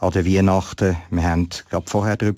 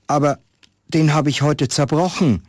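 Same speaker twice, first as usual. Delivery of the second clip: put-on higher, hesitant boy's voice; stammering boy; 7 semitones higher than usual. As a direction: stammer it out like a guilty child. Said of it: "stotterte der Junge". (stotternder Junge, höher und zögernd)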